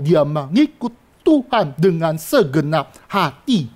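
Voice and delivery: slow, weighty preaching; slow emphasis. Shown as a demonstration. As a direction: drag out each word of "dengan segenap hati". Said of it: "slow emphatic preaching".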